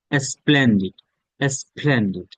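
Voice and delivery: normal talking voice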